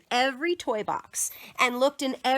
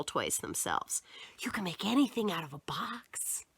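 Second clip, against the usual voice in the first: whisper; 5 semitones lower, 8 dB quieter than usual. Real, not acted. Aside whispered to listener.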